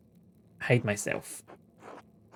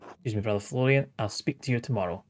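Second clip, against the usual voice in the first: normal talking voice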